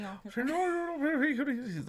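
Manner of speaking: imitated mumbly older neighbour, Mexican accent; soft, unintelligible Mexican-accented mumble; garbled Mexican accent. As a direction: gargle it softly in a thick Mexican accent. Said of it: "like you're gargling salt water".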